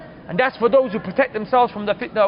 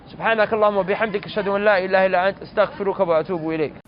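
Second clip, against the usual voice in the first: rapid, low recited supplication; quick murmur-free run of memorised Arabic prayer; closing Arabic prayer; 5 semitones lower, much faster than usual. Real, not acted. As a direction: rattle off the familiar closing dua, low and fast.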